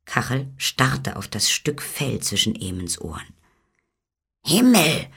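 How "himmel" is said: high, startled exclamation; astonished cry. A narrator's reading. Kachel spots the little man, crying out high and astonished. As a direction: exclaim in amazement, pitched high. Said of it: "rief er".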